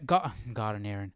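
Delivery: normal talking voice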